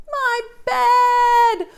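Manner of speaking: high, loud wail of a little bear; loud crying wail. Acted. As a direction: cry it out like a heartbroken cub. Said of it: "wailed Baby Bear".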